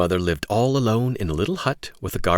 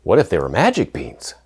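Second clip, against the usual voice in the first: bright, eager hello to the class; excited intro; 8 semitones higher, 7 dB louder than usual. Acted. Teacher welcoming children to a read-aloud, excitedly. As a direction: hype them up for story time.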